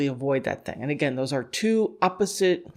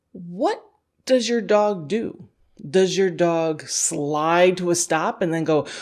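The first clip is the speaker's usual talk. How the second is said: ordinary talk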